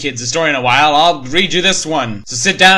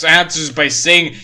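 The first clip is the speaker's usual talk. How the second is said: normal talking voice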